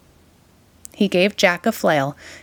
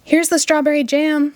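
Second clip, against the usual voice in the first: put-on higher, bright boyish call; excited shout; 8 semitones higher than usual. A narrator's reading. Jack proudly brings the egg, excited shout. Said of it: "shouted Jack".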